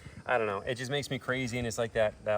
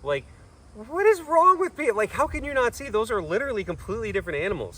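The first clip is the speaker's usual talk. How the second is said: exasperated disbelief; 10 semitones higher, 5 dB louder than usual. Real, not acted. Exasperated at gullible commenters.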